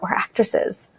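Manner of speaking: breathy, hushed mock-superior put-down; snooty actresses. Actress girls turn down Natalie's dish, snooty whisper. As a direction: sneer it like you're too good for that food. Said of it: "the girls were like".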